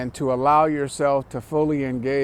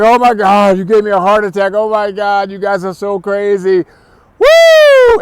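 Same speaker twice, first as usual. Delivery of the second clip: higher and loud, ending in a whoop; relieved outburst; 5 semitones higher, 10 dB louder than usual. Retold or imitated. Relieved victim to the pranksters, loud and excited. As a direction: release the fear with a whooping cheer. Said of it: "And the person's like".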